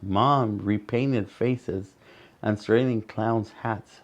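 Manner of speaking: ordinary delivery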